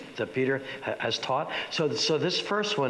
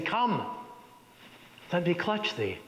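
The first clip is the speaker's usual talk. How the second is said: a high reaching "Come", then a quieter "let me clutch thee"; Macbeth addressing the dagger; 4 semitones higher, 3 dB quieter than usual. Acted. Macbeth calls to the imagined dagger, high then hushed. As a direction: coax the dagger toward you. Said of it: "personifies this dagger by saying".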